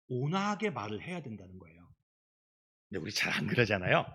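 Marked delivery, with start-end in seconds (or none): none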